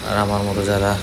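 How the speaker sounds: ordinary talk, nothing marked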